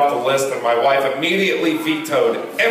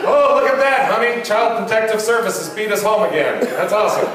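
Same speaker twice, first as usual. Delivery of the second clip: higher, laughing mock cheer; hammed-up happy dad at his doorstep; mock cheerful dad; 6 semitones higher than usual. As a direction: greet disaster as good news. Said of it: "mock cheery parent".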